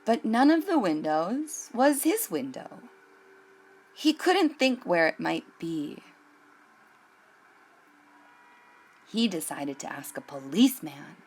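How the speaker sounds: put-on gentle read-aloud voice for children; unhurried picture-book narration; storybook reading. A narrator's reading.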